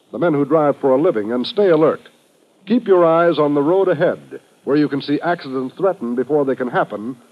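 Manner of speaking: ordinary talk, nothing marked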